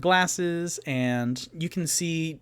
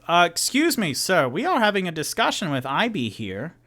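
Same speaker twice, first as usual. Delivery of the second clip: higher, swooping, polite objection; Tutu objecting; 4 semitones higher, faster than usual. Acted. Tutu interrupting the dwarf to object, higher and polite. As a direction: interject with exaggerated courtesy. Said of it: "And Tutu go".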